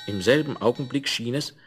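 ordinary delivery

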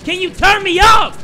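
high, loud yelled question; shouted demand. Acted. Gamer demanding to be turned up, high shout. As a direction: yell the demand with full force.

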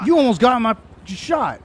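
loud, furious yell; angry shout. Real, not acted. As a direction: blast it at him in real anger. Angry at the player who nearly shot him.

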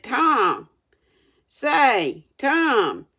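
insistent calling to someone lying still. A narrator's reading. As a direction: call a name to get a response. Sid calls to groaning Tom.

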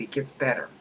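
ordinary delivery

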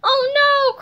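high, loud cry of protest; alarmed cry. A narrator's reading. Harold objecting to George's plan, crying out. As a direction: cry out in dismay. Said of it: "cried Harold".

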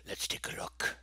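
soft whispered aside. Real, not acted. Teacher inviting viewers to check the choices, whispered.

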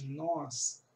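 ordinary talk, nothing marked

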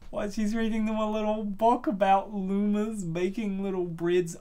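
ordinary talk

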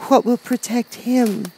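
slow, high-pitched worried question; anxious little girl. A narrator's reading. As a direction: ask anxiously, drawn out, as a child. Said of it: "said the girl anxiously".

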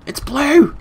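high, loud burst of glee; excited squeal. Real, not acted. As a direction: squeal it in triumph. Repeats discovery, much more excited.

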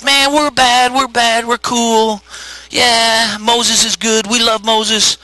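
high-pitched gleeful bragging; mock boasting. Israelites celebrating the Egyptians' defeat, mock boasting. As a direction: crow like a cocky crowd.

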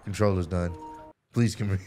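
usual speaking voice

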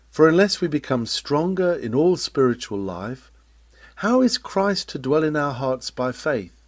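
ordinary talk, nothing marked

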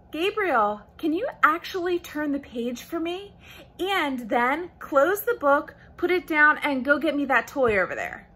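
string out the request for a little one; parent to baby. Shown as a demonstration. Parent asks Gabriel for too much at once, child-directed voice. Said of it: "you wouldn't wanna say".

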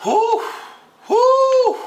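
high, loud whoop of delight; excited whoo. Real, not acted. Unboxing a pair he loves, shouting a high whoop. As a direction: cheer it out, way up high.

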